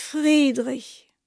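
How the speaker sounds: "Friedrich" leiser und weicher nachgesprochen; "Friedrich" innig in seine Arme hinein sagen; leise wiederholt. Acted. Else in seinen Armen. (Else sagt "Friedrich" leise und innig, schon in seinen Armen)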